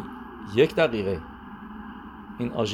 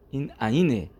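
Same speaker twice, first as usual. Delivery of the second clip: ordinary delivery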